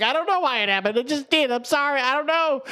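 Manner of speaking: high, tearful mock blubbering; high hammed-up crying apology. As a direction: blubber it like a sobbing kid. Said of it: "sitting there crying".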